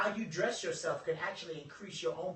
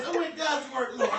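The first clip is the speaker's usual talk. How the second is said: higher and louder, laughing through it; laughing praise; 12 semitones higher, 11 dB louder than usual. Retold or imitated. Jennifer praising the Lord in the corner, laughing. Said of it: "She like".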